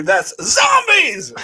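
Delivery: high, slow shouted punchline; joking shouted rebuttal; mock rebuttal shout. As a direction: shout it as the final word. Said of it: "I have a really good rebuttal for you".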